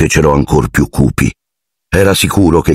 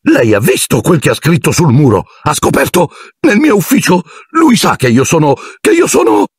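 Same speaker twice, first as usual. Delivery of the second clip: high, frantic, breaking rant; ranting caretaker; 11 semitones higher than usual. Acted. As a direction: spill it out in panic. Filch ranting in distress.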